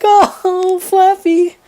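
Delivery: high, wailing mock lament; overdramatic aunt. Aunt commiserating with the pregnant dog, overdramatic. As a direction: ham up a melodramatic cry of sympathy. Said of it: "being overdramatic".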